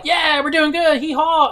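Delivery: high, goofy cowboy whoop; high mock triumphant yee-haw; cocky riders. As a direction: play them smug and carefree. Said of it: "they're like".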